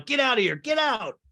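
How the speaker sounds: mock-angry order, rising in pitch; acted angry dismissal. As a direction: throw someone out, half in earnest. [name] throws Philip out, acted quarrel.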